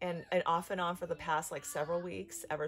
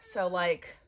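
crying; 4 dB louder, much slower than usual. Real, not acted. Crying between videos about fear.

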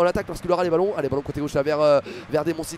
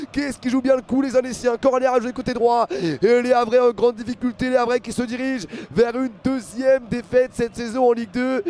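raised, driving football commentary; live play-by-play; 5 semitones higher than usual. Real, not acted. Commentator to viewers as Annecy win a corner, excited play-by-play. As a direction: call the corner with rising urgency.

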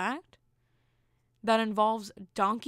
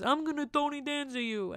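higher-pitched Italian-American impression; Tony Danza; 5 semitones higher than usual. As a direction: put on a playful Italian guy voice, pitched up. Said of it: "sometimes do Tony Danza's voice".

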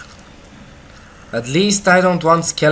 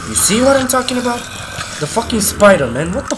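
fast, much higher, louder panicked rant; frantic; 11 semitones higher, 7 dB louder, faster than usual. Real, not acted. Player panicking at a spider attacking him, frantic. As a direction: gabble it as the spider comes for you.

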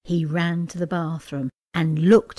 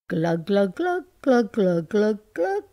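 playful glug glug drinking noises; gulping sounds. Acted. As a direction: mimic gulping down a bottle. Drinking a whole bottle.

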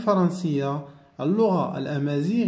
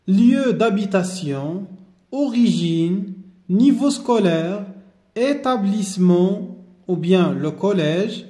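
slow, drawn-out dictation of each heading; much slower than usual. Shown as a demonstration. Teacher dictating table headings to pupils, slowly. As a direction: say each word slowly so pupils can copy. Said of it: "teacher dictates table headings".

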